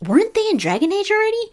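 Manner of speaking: high, quick incredulous question; astonished reaction. Retold or imitated. Fan reacting to other people's impressive contest entries, astonished.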